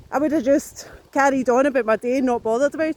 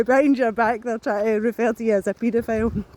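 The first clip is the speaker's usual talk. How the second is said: ordinary talk, nothing marked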